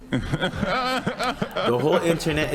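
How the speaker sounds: laughing through the words, slow. Real, not acted. Mocking the crying guy, laughing as he talks. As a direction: chuckle the line out, stretched.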